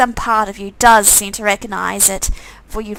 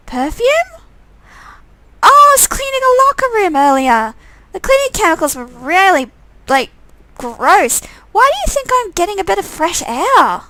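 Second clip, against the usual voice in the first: put-on much higher, bubbly young woman; high girl voice; 8 semitones higher, 4 dB louder than usual. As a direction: play Catelyn, surprised then chatty. Catelyn replies to Tyrone.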